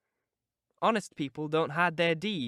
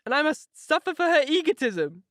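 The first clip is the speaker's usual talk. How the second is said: sobbing; 8 semitones higher, 4 dB louder than usual. A narrator's reading. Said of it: "she sobbed".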